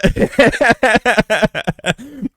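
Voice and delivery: high, loud burst of laughter; real laughing fit at your own story; loud cackle. Real, not acted. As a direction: crack up in a long high cackle.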